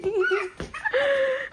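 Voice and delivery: squealing high giggle; high playful laugh. Real, not acted. Laughing as snow is thrown.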